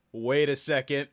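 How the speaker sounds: ordinary talk, nothing marked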